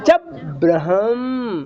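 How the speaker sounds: "चप" cut short, "Brahma" slow and low; stop, then draw out. Shown as a demonstration. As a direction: pause after "चप", stretch "Brahma" to a hum. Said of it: "क्या पढ़ेंगे?". ("चप" छोटा, रुकें, फिर लंबा "ब्रह्म")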